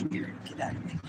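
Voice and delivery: breathy whisper under her breath; whispered aside. Retold or imitated. Telling a neighbor story, whispering to herself. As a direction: whisper it to yourself. Muttered aside in retold encounter.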